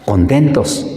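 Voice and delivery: usual speaking voice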